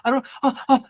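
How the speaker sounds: imitated nervous rookie agent; worried client. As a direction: sound lost and anxious, a bit fast. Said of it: "She's like".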